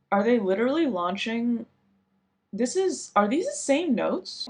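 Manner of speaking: rising disbelief, pitch climbing high; suddenly double-checking in surprise; surprised. Real, not acted. Realising the notes match.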